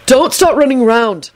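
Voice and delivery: high, insistent instruction; authoritative. A narrator's reading. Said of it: "Neville told Lupin authoritatively".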